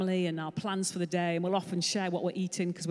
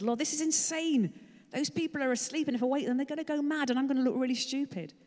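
exasperated protest; 6 semitones higher than usual. Acted. Complaining to God in car.

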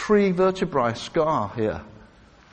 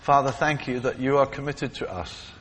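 closing prayer. Acted.

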